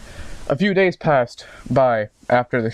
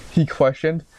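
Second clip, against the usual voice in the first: ordinary delivery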